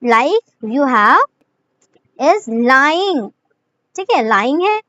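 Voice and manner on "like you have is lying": very slow, each word drawn out; teacher dictating an example word by word; slow dictation. Shown as a demonstration. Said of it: "मैं आपको example दे देती हूँ".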